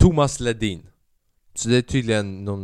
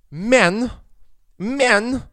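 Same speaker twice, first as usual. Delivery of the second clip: "Men, men," each word punched high and loud; emphatic BUT; 11 semitones higher, 7 dB louder than usual. Acted. Podcaster mocking a picky pizza customer, emphatic "Men, men". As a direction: halt on each "Men", pitched way up.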